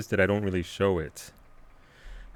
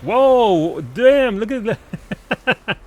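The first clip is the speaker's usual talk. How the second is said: loud, high-pitched burst of real amazement; amazed outburst; 12 semitones higher, 8 dB louder, much slower than usual. Real, not acted. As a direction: exclaim in excited awe, pitch way up. Amazed by a big launch in game.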